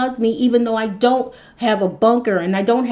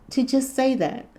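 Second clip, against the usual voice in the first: usual speaking voice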